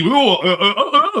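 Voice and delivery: high, mocking whimpers; ridiculing high-pitched "uh" sounds; mock cowering noises. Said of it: "tail right between the legs".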